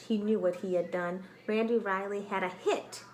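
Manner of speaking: normal talking voice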